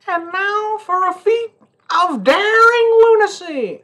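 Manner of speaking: very high, slow, loud daredevil announcement; showman announcer. Acted. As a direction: announce the stunt like a circus barker.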